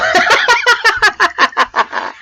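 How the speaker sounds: high, long cackling laugh; laughing at own joke. Real, not acted. Host after his own punchline, high cackle. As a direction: let out a run of high laughter.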